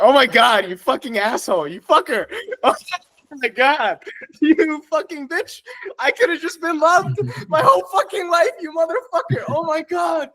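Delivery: very high, squealing cursing through laughter; hysterical, gleeful outrage; laughing rage. Real, not acted. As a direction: squeal the insults while cracking up. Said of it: "it's so funny and it's so loving and it pisses me the fuck off".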